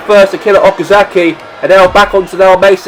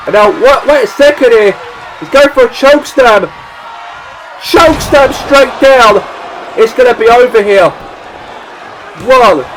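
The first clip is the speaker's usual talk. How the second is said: excited; 5 semitones higher than usual. Acted. Chokeslam call in play-by-play.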